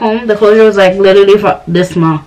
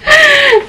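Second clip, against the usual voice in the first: burst of laughter. Real, not acted. Laughs at her own imitation.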